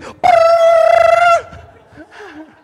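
high, loud burst of laughter; big laugh. Real, not acted. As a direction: roar with laughter. Laughing at brother's joke.

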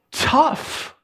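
a curt, breathy tough; one-word breathy retort; blunt. Acted. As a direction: dismiss them flatly.

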